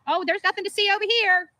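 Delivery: high, dismissive "nothing to see over here"; mocking officer shooing people away; mock cop brush-off. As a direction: wave it off like a cop covering up. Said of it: "he went from being a intoxicated driver to".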